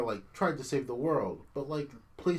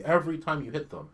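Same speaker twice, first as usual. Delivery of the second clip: usual speaking voice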